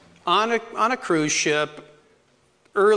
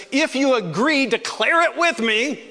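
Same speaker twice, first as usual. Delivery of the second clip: preaching call; 6 semitones higher, slower than usual. Shown as a demonstration. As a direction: rouse the congregation. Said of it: "I often say at the end".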